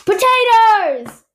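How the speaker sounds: slow, loud, high drawn-out reveal; dramatic announcement. Shown as a demonstration. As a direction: announce it like a drum roll. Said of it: "you're supposed to drum roll now".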